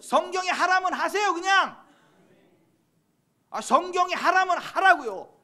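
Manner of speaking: very high, loud pulpit urging; forceful exhorting preaching. Real, not acted. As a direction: drive each command home at a shout.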